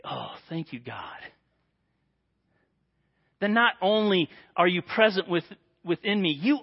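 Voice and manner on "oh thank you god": quiet thanks. Acted. Soft grateful prayer.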